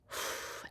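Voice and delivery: soft, voiceless sigh; breathy sigh. Real, not acted. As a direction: let out a quiet breath.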